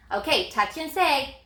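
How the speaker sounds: normal talking voice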